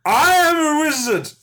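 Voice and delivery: loud, high, slow wizard proclamation. Pretend. Drunk Gandhi unveiling his wizard voice, loud and high. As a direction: boom it out high like a hammy wizard. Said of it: "what's the voice, anyway?".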